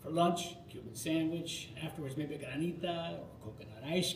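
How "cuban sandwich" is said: plain reading voice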